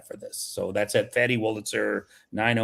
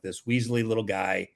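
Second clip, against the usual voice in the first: ordinary delivery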